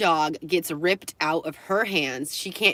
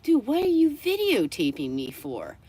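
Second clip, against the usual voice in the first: higher-pitched, incredulous retort; exasperated challenge; 6 semitones higher than usual. Retold or imitated. Beachgoer asks dog owner about filming, reenacted exasperated challenge. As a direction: act out confronting someone without shouting.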